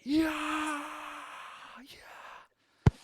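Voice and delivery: high cheering crowd, then a hushed echo; crowd roars. Acted. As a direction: cheer like a roaring arena crowd.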